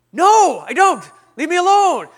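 loud, high mock outburst; hammed-up irritated yelling; exasperated refusal. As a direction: shout the pest away. Retold fending off greeter.

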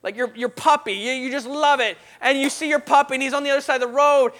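normal talking voice

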